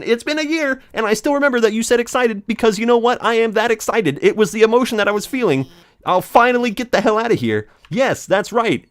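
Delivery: high, fast and giddy; excited high. Acted. Speaker excited to finally leave, giddy and high. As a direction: bubble over with excitement.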